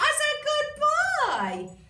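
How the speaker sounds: very high, slow sing-song praise; excited praise. Real, not acted. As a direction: coo it out high at the dog. Cueing dog loudly.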